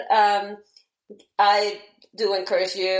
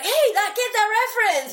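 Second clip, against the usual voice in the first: excited fan; 12 semitones higher than usual. Retold or imitated. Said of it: "see, like".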